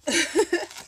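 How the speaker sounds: short high giggle. Real, not acted. Laugh after whispered yes.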